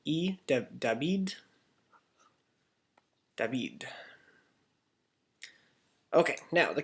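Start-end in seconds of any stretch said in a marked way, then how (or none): 0.8-1.3 David as "dah-BEED", rising at the end; questioning "dah-BEED" for David; ancient Greek vowel. Shown as a demonstration. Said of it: "the ancient vowel would have sounded". 3.4-3.9 David as a soft "dah-BEED", falling at the end; low-key "dah-BEED" for David; ancient Greek vowel. Shown as a demonstration. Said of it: "the ancient vowel would have sounded".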